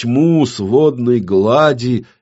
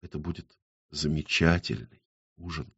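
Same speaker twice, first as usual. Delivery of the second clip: low, hushed murmur; soft whisper; 4 semitones lower, 11 dB quieter than usual. A narrator's reading. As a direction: say it very softly, almost whispered. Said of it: "И прошептала". (тихим, низким шёпотом)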